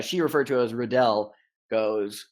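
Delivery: ordinary talk, nothing marked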